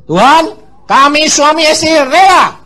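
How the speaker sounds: high, loud cry to God; loud surrendered prayer. Retold or imitated. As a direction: offer the child up aloud. Couple's prayer voiced loudly. (doa penyerahan lantang)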